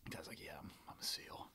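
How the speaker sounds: whispered secret boast; hushed confiding. Retold or imitated. Retelling a fake seal's claim, in a whisper. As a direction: breathe it like a big secret. Retold fake seal bragging in bar.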